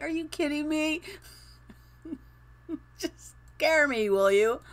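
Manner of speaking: high, laughing exasperated scolding; mock outrage at the prank; laughing disbelief. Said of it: "And it's like".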